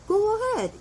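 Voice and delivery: high curt go ahead, falling; imitating cashier. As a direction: mimic an indifferent cashier brushing you off. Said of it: "걔네가".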